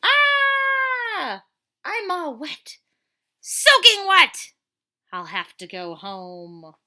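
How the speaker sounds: high loud shriek of dismay, then slow; hammed-up wet whine, high and loud; soaked dismay. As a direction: squeal as the rain hits. Hero gets soaked.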